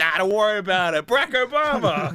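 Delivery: loud, high, giddy brush-off; hammed-up excited shout; starstruck dismissal. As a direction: wave off danger, thrilled by a celebrity. Distracted guard brushes off warning.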